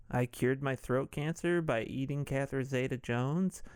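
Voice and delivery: ordinary talk, nothing marked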